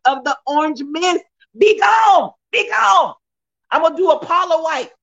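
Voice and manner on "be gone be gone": high shout of casting out; shouted banishing. Acted. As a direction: cast it out loud.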